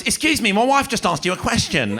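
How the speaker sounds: husband defending his wife, mock indignant. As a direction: puff up and demand an answer. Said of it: "the person next to him goes".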